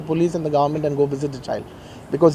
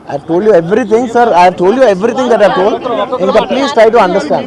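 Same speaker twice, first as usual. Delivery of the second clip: loud, higher-pitched appeal to reporters; insistent pleading; 4 semitones higher, 8 dB louder than usual. Real, not acted. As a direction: raise your voice and plead to be understood.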